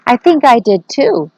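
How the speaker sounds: lightly voiced frightened thief; a rattled thief answers his partner, slightly quivery. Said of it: "said Max in a quivery voice".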